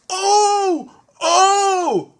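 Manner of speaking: very high loud wailing oh oh oh; Percy dismayed. A narrator's reading. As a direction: wail in dismay at the crash. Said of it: "Percy went".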